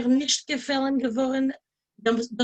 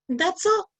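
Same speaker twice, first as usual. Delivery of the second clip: high, louder dismissive that's all; curt; 8 semitones higher, 4 dB louder than usual. Retold or imitated. Cashier dismisses the angry customer. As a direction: brush him off.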